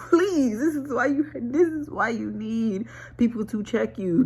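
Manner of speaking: high, laughing plea, slowing down. Real, not acted. Laughing, pleading to be corrected.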